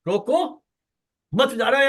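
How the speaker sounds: "रोको" alone, pause, then "मत जा रहा है"; "रोको" rising, pause, "मत जा रहा है"; pause after first word. Shown as a demonstration. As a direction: break after "रोको" to stop someone leaving. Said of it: "एक कॉमा लगा करके उसका अर्थ बदल दिया".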